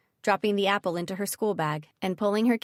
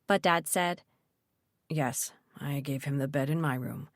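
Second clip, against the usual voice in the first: lower, softer dad voice; father voice; 4 semitones lower, 3 dB quieter than usual. Acted. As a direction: voice the calm father, low.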